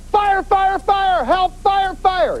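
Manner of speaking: high-pitched, repeated alarm cry; yelling fire. Acted. Woman being pulled into a car, acted high yelling. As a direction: call it out high, over and over. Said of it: "she's yelling".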